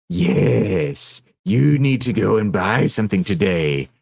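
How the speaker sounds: a lightly voiced goofy joker, barely off the own voice; half-hearted cartoon tempter egging you on; Derpy Joker voice. Shoulder joker tempting the viewer to buy, lightly voiced. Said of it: "come up with a good Derpy Joker voice".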